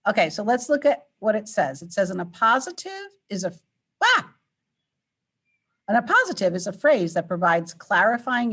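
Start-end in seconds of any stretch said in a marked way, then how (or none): 4.0-4.3 startled yelp. Real, not acted. Startled by the screen.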